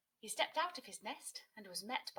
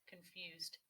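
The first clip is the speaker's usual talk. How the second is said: usual speaking voice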